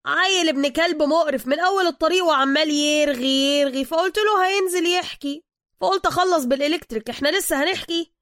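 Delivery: high, loud exasperated rant; ranting driver. Acted. Driver rants about his talkative passenger, loud and high.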